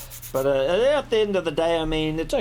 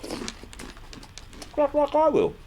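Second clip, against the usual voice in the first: slow high voice; 13 semitones higher, much slower than usual. Acted. Rabbit's doom line, slow and high.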